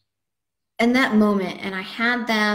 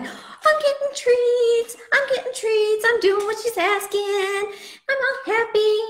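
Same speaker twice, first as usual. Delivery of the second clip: happy dog voice; 13 semitones higher than usual. Retold or imitated. Trainer voices the dog getting its treats, happy high voice. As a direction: squeal it out like an overjoyed puppy getting treats. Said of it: "And then, like".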